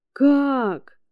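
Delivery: high, loud cry of disbelief; shocked cry. Acted. Харрис узнаёт страшную новость. (потрясённый громкий возглас)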